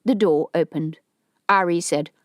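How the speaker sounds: ordinary delivery, nothing marked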